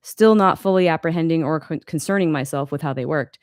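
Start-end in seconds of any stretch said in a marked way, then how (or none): none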